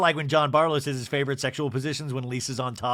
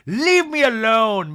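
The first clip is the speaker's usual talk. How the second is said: loud, high, drawn-out demand; acted-out raised voice; imitated outburst; 7 semitones higher, 8 dB louder, slower than usual. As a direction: stretch it out as a mock loud protest. Acting Meredith's outburst in retelling.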